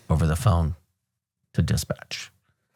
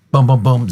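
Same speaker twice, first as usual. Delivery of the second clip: loud, punchy spoken booms; gunshot sounds; 5 semitones higher, 6 dB louder than usual. Retold or imitated. Telling the shootout, loud mock gunfire booms. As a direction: mimic the rookie's shots.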